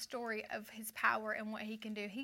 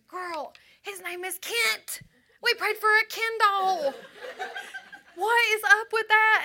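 high, loud, laughing squeal; excited friend; 11 semitones higher, 13 dB louder than usual. Retold or imitated. Friend calling with news he phoned, shrieking and laughing. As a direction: shriek it through laughter, thrilled. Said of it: "She goes".

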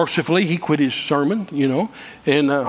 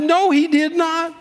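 high, drawn-out angry contradiction; slow, heated rebuttal; emphatic denial; 12 semitones higher, slower than usual. Retold or imitated. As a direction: re-enact refusing to accept it, pitch way up. Retold argument, flat contradiction of preacher.

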